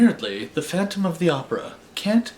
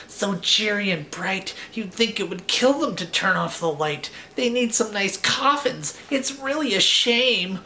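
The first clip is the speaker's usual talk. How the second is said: put-on higher complaining kid vampire; gloomy young vampire; 8 semitones higher than usual. A narrator's reading. Young Dracula complaining in the waiting room, higher whiny voice. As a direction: sulk about the bright room. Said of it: "young Dracula said".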